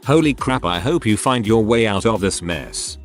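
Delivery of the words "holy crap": ordinary delivery, nothing marked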